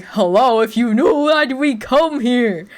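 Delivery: mock outrage at a pointless trip to the store; exasperated. Exasperated reply to mom retold.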